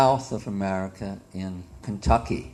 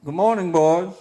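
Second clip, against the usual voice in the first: put-on loud, raised Southern old-timer voice; old campground proprietor; 5 semitones higher, 6 dB louder than usual. Campground owner greeting the boys, loud Southern old-timer voice.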